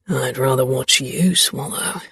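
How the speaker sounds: lightly voiced low growl; a hint of a flirty growl. A narrator's reading. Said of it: "he growled".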